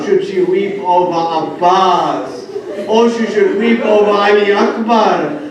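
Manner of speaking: rising from plain to a loud high wail; building lament. Acted. Zainab lamenting over her fallen kin, building to a wail. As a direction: let each question climb in grief.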